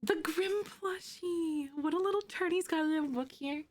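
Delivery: high cooing over the plushie gift; cute-voice gushing. Real, not acted. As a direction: coo over the little toy.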